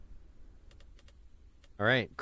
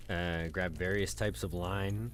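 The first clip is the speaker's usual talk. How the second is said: ordinary talk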